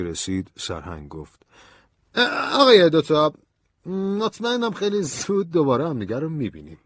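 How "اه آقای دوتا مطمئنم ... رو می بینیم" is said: high colonel's voice, loud opening; knowing colonel. A narrator's reading. Colonel calling Ben back at the door, high and pointed. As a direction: call after him in a raised pitch.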